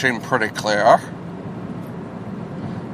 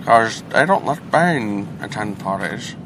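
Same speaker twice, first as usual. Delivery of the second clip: Bane voice; 5 dB quieter than usual. Acted. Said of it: "that voice kinda reminds me of".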